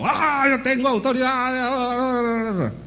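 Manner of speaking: mock shout of authority. Preacher mocks those who think authority means shouting, slow high mock shout. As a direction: ham up a preacher bellowing his own authority. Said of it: "eso significa gritando".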